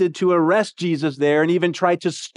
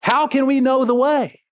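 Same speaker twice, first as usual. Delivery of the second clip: mildly voiced pushback, a touch higher; disagreeing; 7 semitones higher than usual. Thomas pushing back at Jesus, mild disagreeing tone. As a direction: question him bluntly, lightly acted. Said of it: "almost in a disagreeing way".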